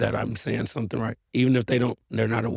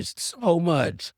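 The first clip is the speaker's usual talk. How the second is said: lightly voiced daughter, pitched up; 6 semitones higher than usual. Daughter telling dad how loved she is, lightly imitated higher voice. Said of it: "my daughter is like".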